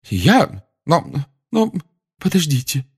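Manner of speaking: high stammering protest; protest, stumbling; flustered Trelawney. A narrator's reading.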